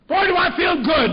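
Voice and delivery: loud, high-pitched burst of joy; elated exclamation. Retold or imitated. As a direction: act a doctor bursting with good spirits. Retold elated sober doctor returns.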